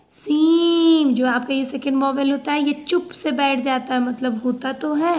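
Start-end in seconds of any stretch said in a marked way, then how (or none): 0.1-1.1 सीम drawn out very slowly; stretched word. Shown as a demonstration. Teacher modelling सीम for pupils, very slow. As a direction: hold the ई in सीम long. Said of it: "okay".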